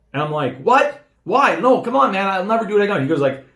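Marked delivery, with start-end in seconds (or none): 0.6-3.0 high-pitched disbelief turning to pleading; retold pleading protest. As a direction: protest the news as you re-enact it, pitched well up. Re-enacting protest at being fired.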